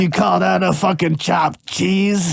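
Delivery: mock a Manhattan Italian guy, stretched out and indignant; exaggerated Italian-American New Yorker. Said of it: "real Italian Manhattan guys".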